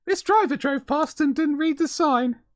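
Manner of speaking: mock the breathless clip narrator; TikTok narrator voice. Vlogger mocking viral bus-crash clips, hammed-up narrator voice. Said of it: "in that voice".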